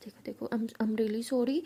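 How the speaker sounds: hushed, breath-soft apology; gently placate, almost whispering; soothing apology. Acted. Pihu apologises to crying girlfriend, soothing apology.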